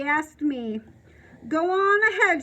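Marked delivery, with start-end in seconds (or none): 1.5-2.4 a wheezy, winded plea, a bit louder; lightly wheezed line from the out-of-breath neighbor; wheezing. A narrator's reading. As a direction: push it out short of breath. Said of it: "she wheezes".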